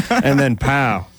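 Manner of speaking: usual speaking voice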